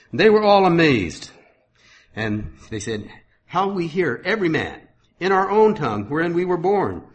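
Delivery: scripture reading. Acted. Preacher reading Acts to his congregation, proclaiming. Reads scripture aloud in sermon.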